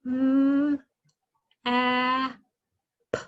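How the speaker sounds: a hint of a child saying the sounds; lightly voiced child repeating Mm, a, p; child echoing the sounds to a parent, segmented; child sounding out. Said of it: "the child thinks and says".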